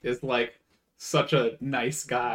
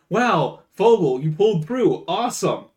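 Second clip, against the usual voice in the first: impressed praise; 6 semitones higher, 5 dB louder than usual. Retold or imitated. Acted impressed friend in retelling.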